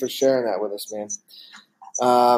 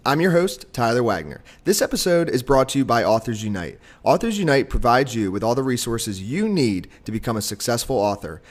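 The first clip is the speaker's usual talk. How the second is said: polished sponsor read with music under it; podcast ad read. Acted. Host reads the sponsor spot in an ad voice. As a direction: read it like a recorded podcast intro.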